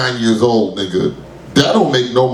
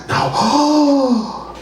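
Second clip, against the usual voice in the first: high shocked no; imitating the shocked audience; mock horror; 12 semitones higher than usual. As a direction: gasp it out in dismay. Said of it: "all of y'all was just like".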